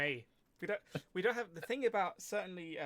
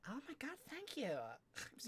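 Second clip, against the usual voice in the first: high, soft mock-girly gush; girly thank-you, hammed up; put-on girly voice; 8 semitones higher, 6 dB quieter than usual. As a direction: play a flattered girl, high and soft. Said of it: "[name] and [name] as girls".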